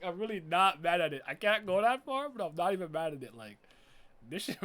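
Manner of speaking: ordinary delivery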